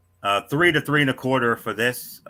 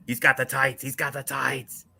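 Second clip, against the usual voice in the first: higher, held-back mock scream; retold screaming; 4 semitones higher, 3 dB quieter than usual. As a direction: scream it without the volume. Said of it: "when I was screaming".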